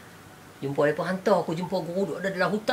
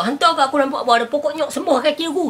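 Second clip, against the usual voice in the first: boastful disciple; 10 semitones higher, 8 dB louder, faster than usual. Retold or imitated. Disciple boasting of his vision.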